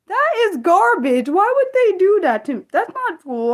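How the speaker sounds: high, emphatic indignant complaint; outraged. Real, not acted. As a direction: object loudly, pitch shooting up. Protests unfairness to her viewer.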